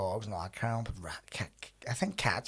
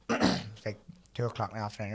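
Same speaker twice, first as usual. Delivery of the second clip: ordinary talk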